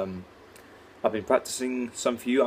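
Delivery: normal talking voice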